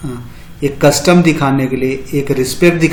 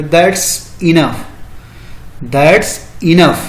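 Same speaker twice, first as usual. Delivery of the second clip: very slow, each word of "That's enough" drawn out; slow demo phrase; much slower than usual. Shown as a demonstration. Teacher modelling the English reply for students, very slow. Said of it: "तो उसको English में बोलेंगे".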